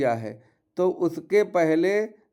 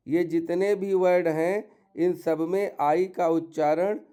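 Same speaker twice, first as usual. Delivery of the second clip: ordinary talk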